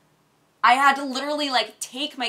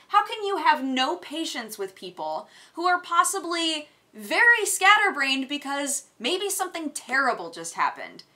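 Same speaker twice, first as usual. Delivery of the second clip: ordinary talk, nothing marked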